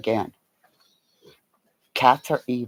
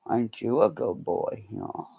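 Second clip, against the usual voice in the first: praising the dog; 3 semitones lower, 8 dB quieter than usual. Real, not acted.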